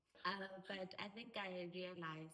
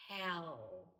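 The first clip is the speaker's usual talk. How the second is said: much louder, heavy falling hell; emphatic hell; 11 dB louder than usual. Real, not acted. Telling a friend her period was hell, emphatic. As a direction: land the word hell hard. Describing how bad periods were.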